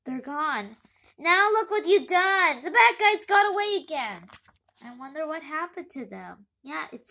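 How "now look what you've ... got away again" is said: loud, high exasperated scold. Acted. Chief scolds Dogman for letting villains escape, loud. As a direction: blame them loudly for the escape.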